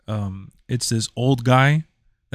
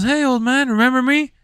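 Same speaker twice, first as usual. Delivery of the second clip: high, fast squeaky thug voice; mock a goofy street robber, way up high; thief voice; 12 semitones higher than usual. Thief voice again.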